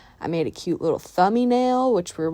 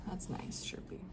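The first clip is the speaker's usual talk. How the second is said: whispered sarcastic aside; dry whisper; 20 dB quieter than usual. Real, not acted. Annoyed owner whispers to cat with the butt in her coffee, dry whisper. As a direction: breathe it out, deadpan and unvoiced.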